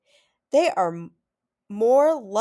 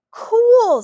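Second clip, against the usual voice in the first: excited cool; 14 dB louder than usual. A narrator's reading. Stanley reacts to favorite fish.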